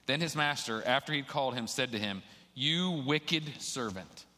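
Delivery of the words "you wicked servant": very slow, drawn-out rebuke; stern condemnation. Acted. Master calls in the unforgiving servant, slow stern condemnation. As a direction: stretch each word out in stern judgment.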